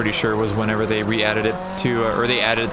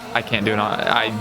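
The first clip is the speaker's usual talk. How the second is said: ordinary delivery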